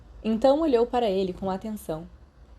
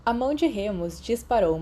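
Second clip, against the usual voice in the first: usual speaking voice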